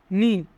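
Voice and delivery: নি with the carried-over n starting it; linked final consonant. Shown as a demonstration. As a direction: link the final n into নি. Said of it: "বাচ্চিমটি young এর স্থানে যাবে". (নি, ন জুড়ে উচ্চারণ)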